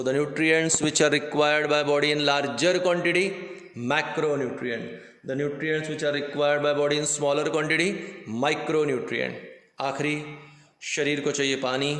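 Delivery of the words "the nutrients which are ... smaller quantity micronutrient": slow dictation. Acted. Teacher dictating English definition.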